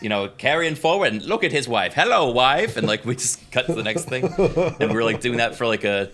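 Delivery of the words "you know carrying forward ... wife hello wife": loud, slow twenties newsreel announcer; old-timey announcer. Pretend. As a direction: read it like vintage wartime propaganda. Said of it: "announcer voice".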